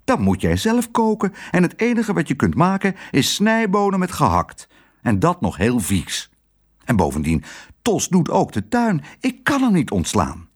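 high, agitated protest; alarmed husband. A narrator's reading. Husband to wife, high and alarmed. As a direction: defend the cook in a panic. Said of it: "riep haar man verschrikt".

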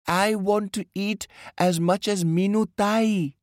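put-on high-pitched little kid's whine; wailing small child. A narrator's reading. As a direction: wail like the youngest wanting an equal share. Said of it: "wailed little Sonu".

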